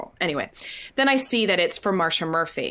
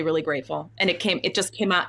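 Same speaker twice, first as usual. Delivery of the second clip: ordinary talk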